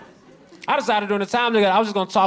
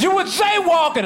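high-pitched mock police shout; accusing cop shout; 13 semitones higher, 4 dB louder than usual. Montreal cop to a jaywalker, high accusing shout. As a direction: imitate an outraged cop catching someone out.

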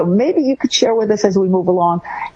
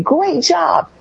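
gushing praise; 10 semitones higher than usual. Shown as a demonstration. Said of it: "instead of saying".

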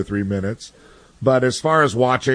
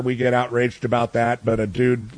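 ordinary talk

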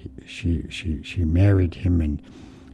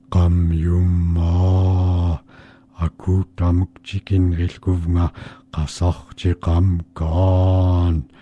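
very slow, eerie drawn-out chant; strange voice; slower than usual. Acted. Monster at Mink's door calling in Yupik, slow eerie chant. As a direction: intone it like something lurking outside the door. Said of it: "she heard this strange voice".

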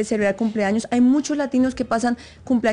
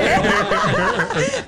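laugh; 14 semitones higher than usual. Real, not acted. Laughing at own impression.

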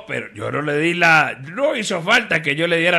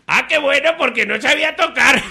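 high, delighted sidekick punchline; Jorgito; 9 semitones higher, 3 dB louder than usual. Acted. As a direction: deliver the punchline in a high, excited little voice. (vocecita aguda y alegre de ayudante)

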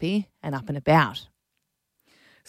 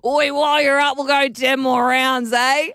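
pub regular; 6 semitones higher than usual. Pretend. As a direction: call out like a mate at the bar. Playing a pub regular toddler.

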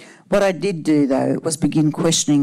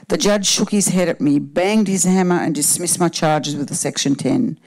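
ordinary talk, nothing marked

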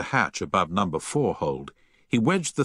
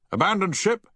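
much higher, raised shout; shouted order; 12 semitones higher than usual. A narrator's reading. Captain orders abandon ship, shouting. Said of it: "shouted Havens".

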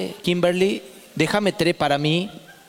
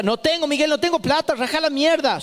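exasperated mother; 9 semitones higher than usual. Retold or imitated. Mother snaps back at son.

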